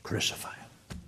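whispered crucify him; a crowd's shout turned to a hushed whisper; hushed crowd cry. A narrator's reading. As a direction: breathe the mob's cry as a whisper. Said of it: "And they shouted".

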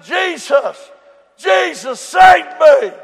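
building from a call to a loud, high plea; pleading cry rising to a shout. Retold or imitated. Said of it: "I cried".

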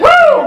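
high, loud laughing whoop; happy shout of glory; joyful whoop. Real, not acted. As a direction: let out a whoop of joy. Preacher shouts in joy.

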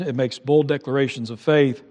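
normal talking voice

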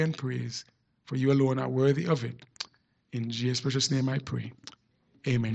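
low, soft prayer voice; prière. Real, not acted. Leads opening prayer.

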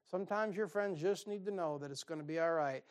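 ordinary delivery

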